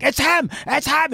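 high, loud, breathless-style exclamation; excited. Retold or imitated. Agent telling colleagues he found the suspect, acted-out excitement. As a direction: blurt it out in mock high excitement. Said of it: "he's stumbling over his words 'cause he's so excited".

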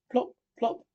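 two high, separate plops; little high-pitched drip imitation; dripping tear noise. A narrator's reading. Narrator voicing Toad's tears hitting the table, comically.

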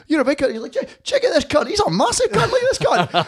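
high mocking bloke, laughing through it; mock stranger voice. Storyteller voices pub stranger teasing his big mate, high and laughing. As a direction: pitch it way up and laugh as the pub guy.

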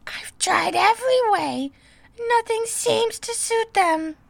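high, loud pigeon wail; a touch of a sob in a high bird voice; kind of sob. Acted. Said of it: "added with a kind of sob".

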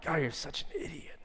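soft aside, berating yourself; mock self-reproach. Preacher voicing negative self-talk, soft mock self-reproach. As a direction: quietly scold yourself, acted out. Acted muttered self-criticism.

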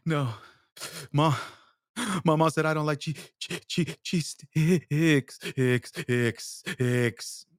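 hammed-up hiccuping sobs; mock crying. Crying kid turning down a friend's snack, mock sobbing. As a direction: stutter and gasp, words breaking into sobs. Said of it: "crying your fucking eyes out".

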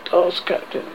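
usual speaking voice

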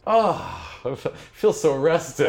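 high, hammed-up contentment; mock delight after the fight; blissful sigh. GM mocks blissful satisfaction.

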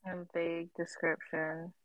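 half-voiced musing under her breath; muttering to herself. Real, not acted. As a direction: repeat it quietly to yourself while thinking. Echoing the prompt to herself.

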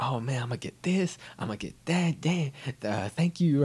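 high-pitched gleeful gloating; hammed-up thrill, pitched high; excited character. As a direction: play up the giddy windfall excitement. Character gloats over newfound money.

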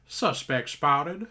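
slow, flat police callout; robotic cop voice. Retold or imitated. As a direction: announce it like a game cop. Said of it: "he goes".

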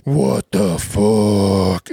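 long drawn-out, low gravelly growl; mock gravelly devil roar. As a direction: imitate a screaming woman as a slow, low demon. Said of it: "Did she go devil voice?".

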